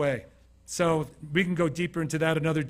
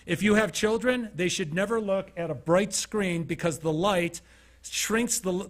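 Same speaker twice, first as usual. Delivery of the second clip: normal talking voice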